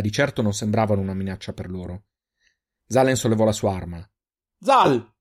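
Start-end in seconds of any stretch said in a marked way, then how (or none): 4.6-5.1 sudden high, loud shouted name. A narrator's reading. Reynal stops his armed friend, shouting high and loud. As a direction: call out sharply to stop him. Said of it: "urlò Reynal".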